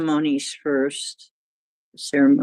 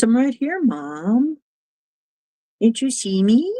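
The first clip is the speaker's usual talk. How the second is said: higher-pitched little pet voice; voicing the cat; 6 semitones higher than usual. Cat calling to its mom, high put-on pet voice. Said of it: "He says".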